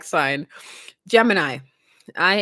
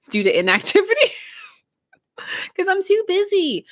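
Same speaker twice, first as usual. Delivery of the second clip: laughing; 12 semitones higher, faster than usual. Real, not acted. Laughs at own confession.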